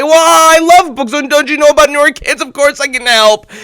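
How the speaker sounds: high, loud, fast gushing; eager delight. Retold or imitated. Amin telling his friend he loves books, loud eager delight. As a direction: gush with excitement.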